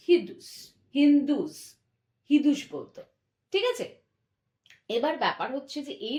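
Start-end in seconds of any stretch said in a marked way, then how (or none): 0.1-2.6 হিদুস said with an h sound, loud and clear; Persian pronunciation. Shown as a demonstration. Teacher shows students how the Persians said it. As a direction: stress the h in হিদুস. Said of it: "এই অঞ্চলটাকে তারা বলতো".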